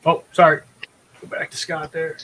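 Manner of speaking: usual speaking voice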